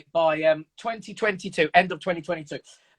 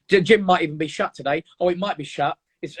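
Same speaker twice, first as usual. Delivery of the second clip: usual speaking voice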